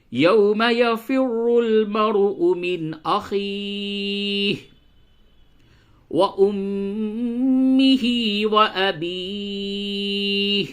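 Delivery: slow chanted recitation, rising high; Quran recitation. Acted. Teacher recites the verses to his students. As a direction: chant slowly, holding the vowels.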